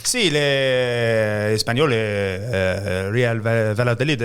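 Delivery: halting fake Spanish, drawn out at first; mock Spanish. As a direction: grope for Spanish-sounding names, hammed up. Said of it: "absolute random Spanish names".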